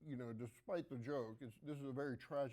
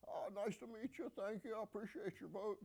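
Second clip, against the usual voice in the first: much higher, glad-handing politician voice; Bill Clinton impression; 8 semitones higher than usual. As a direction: schmooze the room like a campaigning president. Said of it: "That's my Bill Clinton impersonation".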